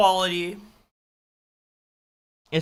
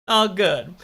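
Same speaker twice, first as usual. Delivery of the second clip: ordinary delivery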